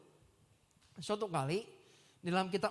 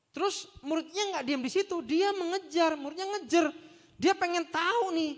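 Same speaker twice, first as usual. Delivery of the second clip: rising, louder and higher; excited preaching; 7 semitones higher, 4 dB louder than usual. Real, not acted. Preacher rousing the congregation about eager disciples. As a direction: build the eagerness up.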